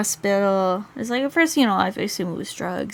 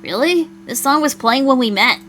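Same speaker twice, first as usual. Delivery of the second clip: higher, louder disbelief; surprised reproach; 4 semitones higher, 6 dB louder than usual. Acted. Partner learning their song was forgotten, surprised reproach. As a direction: react with raised, incredulous surprise.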